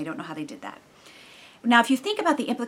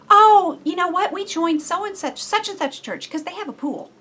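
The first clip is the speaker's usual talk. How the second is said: much higher, louder breezy excuse; chirpy acquaintance; 10 semitones higher, 8 dB louder than usual. Retold or imitated. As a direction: toss off the excuse lightly, chirpy. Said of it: "She goes".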